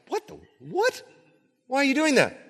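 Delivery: high, squeaky incredulous questions; hammed-up bewildered reaction; mock bafflement. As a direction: pitch way up in comic disbelief. Voices baffled disciples' reaction.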